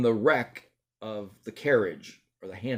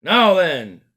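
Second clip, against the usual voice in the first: loud stern constable; firm call to order; policeman; 5 semitones higher, 9 dB louder than usual. Acted. Policeman takes charge.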